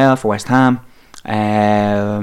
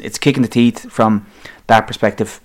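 ordinary delivery

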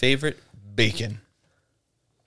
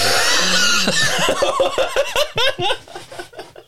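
high, loud whining jet take-off; airplane take-off noise; 4 dB louder than usual. Pretend. Imitating an airplane taking off, loud high whine. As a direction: rev up like a plane leaving the runway. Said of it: "my impression of an airplane taking off".

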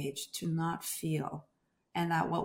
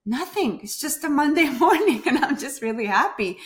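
higher, louder, with a laugh in it; laughing happily; 7 semitones higher, 7 dB louder than usual. Retold or imitated. Answering her friend's why, laughing and high. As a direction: giggle through the explanation.

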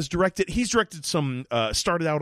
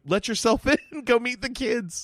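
breezy quote; 9 semitones higher than usual. Retold or imitated. Quoting the parents leaving.

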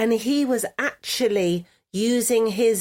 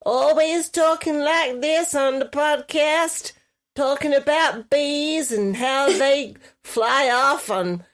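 put-on southern US accent; 3 semitones higher than usual. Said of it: "that's your best accent".

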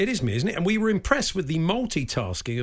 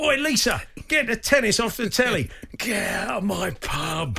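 hammed-up EastEnders barmaid shouting at the telly; Cockney landlady; 6 semitones higher than usual. Radio host imitating a Cockney landlady at Lisa, hammed-up Cockney shout. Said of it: "It's like she's running the Vic".

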